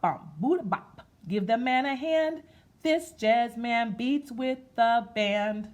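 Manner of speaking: slow, sing-song rhythmic reading; read-aloud rhyme. Acted. Teacher reading a jazz picture book to kids, in a slow sing-song. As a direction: chant it like a nursery rhyme, slow.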